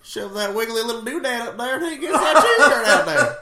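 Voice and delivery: high, loud, laughing Southern yokel; Southern accent. Acted. Co-host explaining the eel cure, exaggerated laughing Southern accent. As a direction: hold forth in hammed-up Southern. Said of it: "much stronger Southern accents".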